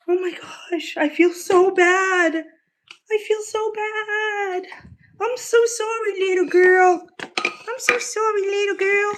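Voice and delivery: high, slow pet-voice sorry. Real, not acted. Apologising to the hungry cat in high baby talk. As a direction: coo sorry to her, way up high.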